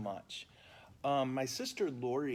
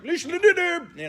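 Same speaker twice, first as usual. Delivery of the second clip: gabbled names; 11 dB louder than usual. Shown as a demonstration. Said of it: "the names of the saints are like".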